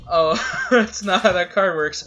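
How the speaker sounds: talking through a laugh. Real, not acted. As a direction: laugh out each word. Laughs at opponent's misplay.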